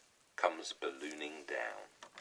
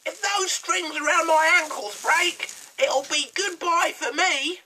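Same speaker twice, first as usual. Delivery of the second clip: shrill witch voice, much higher and louder; gasping hag; 14 dB louder than usual. A narrator's reading. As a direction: squawk it like a panicky old hag. Mrs Twit frets.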